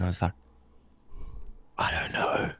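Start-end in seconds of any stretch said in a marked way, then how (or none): 1.8-2.5 hushed, dazed whisper; stoned whisper. Retold or imitated. Answering his mate in a baked, whispered daze. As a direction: breathe it out, totally zoned out. Stoned reply to mate's question.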